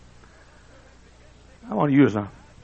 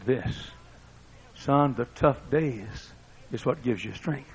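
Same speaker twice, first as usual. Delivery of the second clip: soft slow counsel; 3 semitones lower, 9 dB quieter, slower than usual. Retold or imitated.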